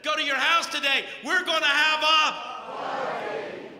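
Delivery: loud, high friendly call up the tree; joyful yell. Retold or imitated. Jesus calling Zacchaeus down from the tree, loud joyful yell. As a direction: holler a cheerful invitation. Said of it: "He yelled".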